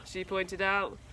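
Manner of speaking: usual speaking voice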